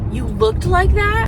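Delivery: high, loud, slow incredulous question; hammed-up shock at your own look; mock disbelief. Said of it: "I go out in public and I'm like".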